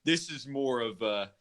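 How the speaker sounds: ordinary delivery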